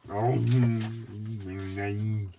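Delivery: very slow, drawn-out, loud hippo voice; George voice. Retold or imitated. [name] doing George to laugh off the maths, very slow. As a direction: drag the words like a dopey hippo. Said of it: "it just turns into my".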